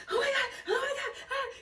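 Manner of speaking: very high squealed exclamation; high, laughing mock scream; super excited. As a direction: re-enact a squeal without full volume. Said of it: "I'm just super excited".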